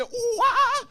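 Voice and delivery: high, loud monkey call; hammed-up monkey hoots; monkey noises. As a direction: hoot like an ape. Imitating monkey sounds.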